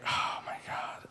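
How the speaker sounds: breathless whisper of shock; stunned whisper. Retold or imitated. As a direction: whisper it, hit by the news. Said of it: "And I was like".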